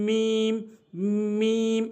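"মিম" with lips pressed, m held long; মিম demonstration. Shown as a demonstration. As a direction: hum into and out of "মিম". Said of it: "এভাবে আমরা বলবো". ("মিম", দুই ঠোঁট মিলিয়ে টেনে)

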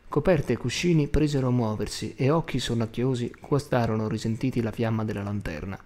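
usual speaking voice

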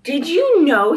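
ordinary delivery, nothing marked

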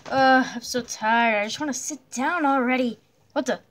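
ordinary delivery

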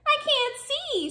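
very high little snail voice; fretting snail. A narrator's reading. Snail lost in dark seaweed forest, high fretting. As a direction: squeak it as a tiny worried creature.

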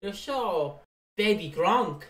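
lightly voiced kid spouting brainrot; hammed-up Teddy talking youth slang; teen slang voice. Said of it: "what kids of now use".

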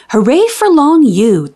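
ordinary delivery, nothing marked

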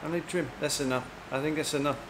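ordinary talk, nothing marked

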